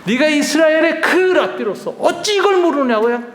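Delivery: high, loud rebuke; scolding shout. Acted. Jesus scolding Nicodemus, the teacher of Israel, with a loud shout. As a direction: shout him down like a teacher scolding his pupil. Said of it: "굉장히 야단을 칩니다".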